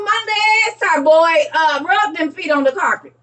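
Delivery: lightly voiced excited children; excited kids. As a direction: kids begging mama. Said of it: "Them kids about to be telling Mama".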